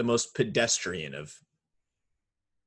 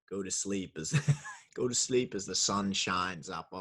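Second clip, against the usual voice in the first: mock sleep meditation; 4 semitones lower, 3 dB quieter than usual. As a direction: try to soothe but keep cracking up. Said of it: "I can't do it".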